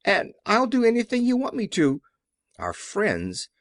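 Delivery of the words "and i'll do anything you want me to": high, frightened begging; pleading, trembling. A narrator's reading. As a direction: beg not to be struck, voice rising. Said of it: "in a trembling voice".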